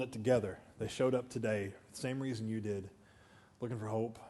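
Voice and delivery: low, soft pastoral delivery; hushed earnest preaching. Real, not acted. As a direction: bring the sermon down low and soft.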